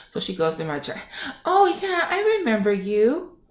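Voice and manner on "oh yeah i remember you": high-pitched put-on nurse voice; imitated nurse. Nurse recognizing a patient from the chart, high mimicking voice. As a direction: mimic her in a high, drawn-up voice.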